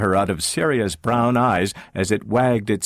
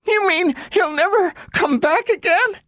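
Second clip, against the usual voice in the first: put-on very high, shocked child voice; girl fearing Robbie won't return; horrified girl. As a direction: ask in horror.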